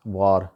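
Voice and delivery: वार with a long "aa", vaar; local pronunciation. Shown as a demonstration. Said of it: "इसको वहाँ पे वार बोला जाता है".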